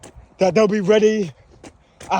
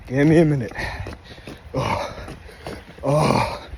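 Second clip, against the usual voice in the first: panting between words, drained; out of breath; 8 semitones lower, 4 dB quieter than usual. Real, not acted. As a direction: gasp it out mid-run. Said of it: "getting a bit out of breath".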